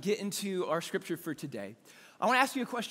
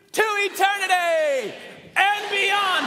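higher, louder, slow shout of the catchphrase; rally shout; 10 semitones higher, 6 dB louder, slower than usual. Real, not acted. Pastor leading congregation in shouted catchphrase. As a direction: lead the whole church in a roar. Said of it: "are you ready".

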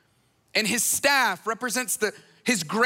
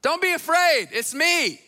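imitated call, higher and louder at the start; casual calling out; 6 semitones higher, 3 dB louder than usual. Jesus calls out to the frightened followers in the boat, casual raised call. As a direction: call out reassuringly, voice lifted. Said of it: "just casually calls out to them".

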